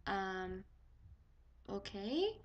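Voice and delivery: very soft, reluctant okay, rising at the end; small uneasy voice; awkward hesitation. Retold or imitated. Patient to doctor who won't leave, awkward and hushed. Doctor stays while she undresses.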